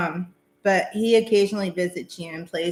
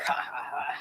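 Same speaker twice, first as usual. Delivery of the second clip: soft whispered ha ha ha, mimicking Papa; imitated laugh; 7 semitones lower, 9 dB quieter than usual. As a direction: breathe out a quiet whispered laugh like the late father. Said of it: "like that".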